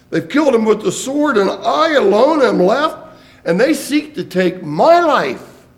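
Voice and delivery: preacher voicing Elijah's loud lament; impassioned preaching. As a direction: pour out Elijah's complaint from the pulpit. Elijah's complaint preached at force.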